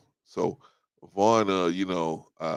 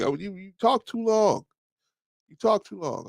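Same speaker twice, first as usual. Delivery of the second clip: mock complaint; 11 semitones higher than usual. Guests tell host he talks too long, high mock griping. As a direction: nag in a raised, put-on pitch.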